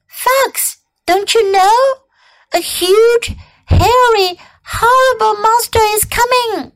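high, emphatic storybook rabbit; alarmed rabbit. Acted. Rabbit warns fox of the monster, high and alarmed. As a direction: build alarm word by word.

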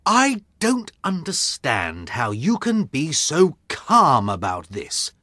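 a touch of irritation; cross. A narrator's reading. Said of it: "said Watson crossly".

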